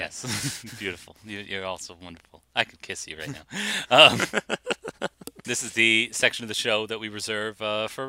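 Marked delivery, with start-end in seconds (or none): 0.0-3.4 tender. Pretend. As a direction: croon it lovingly to the audience. Gushing at listeners.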